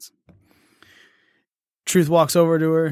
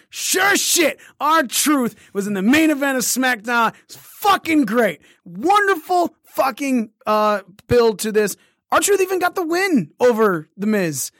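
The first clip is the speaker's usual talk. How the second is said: excited raving; 8 semitones higher, slower than usual. Real, not acted. Podcaster raving to his co-host about the main event, ecstatic and high-pitched. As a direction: rave about it like the best news ever.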